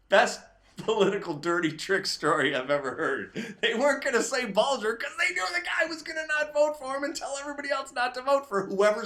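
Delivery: cracking up at the story; real laughter through the words; laughing. Real, not acted.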